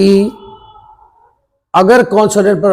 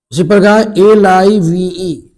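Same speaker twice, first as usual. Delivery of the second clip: ordinary delivery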